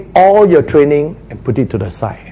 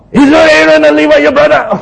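higher, louder angry rebuke; angry God; 13 semitones higher, 6 dB louder, faster than usual. Acted. Preacher imitating God angrily scolding Moses, higher and louder. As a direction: scold him like a furious God. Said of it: "so God is angry again".

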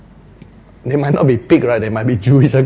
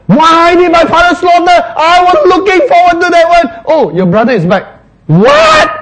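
indignant whine; 13 semitones higher, 6 dB louder than usual. Acted. Elder brother griping about his returned brother, high angry whine. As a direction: complain bitterly, voice pitched up.

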